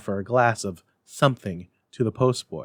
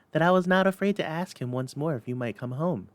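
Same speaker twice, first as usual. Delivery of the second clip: put-on little girl voice; 6 semitones higher than usual. A narrator's reading. Child continues her speech.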